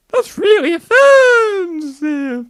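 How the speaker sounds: high, slow indignant ghost; offended ghost. Pretend. Offended ghost scolding the child, slow high voice. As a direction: sniff at the costume, slow and high.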